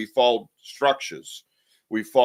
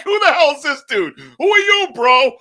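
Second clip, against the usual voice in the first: higher and louder, baffled outburst; Bigfoot's reaction; 14 semitones higher, 9 dB louder than usual. Retold or imitated. Bigfoot reacting to a loudmouth in the woods, baffled yell. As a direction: play a confused Bigfoot yelling back. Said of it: "He must be like".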